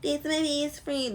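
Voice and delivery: high, sugary fake-friend gushing; imitating the over-eager user friend; mock fake friend. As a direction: gush like a clingy fake friend. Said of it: "them the ones that be like".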